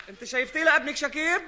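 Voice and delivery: ordinary talk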